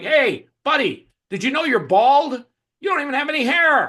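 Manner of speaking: loud, high-pitched jeering troll; mocking heckler. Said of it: "some of those people are gonna be like".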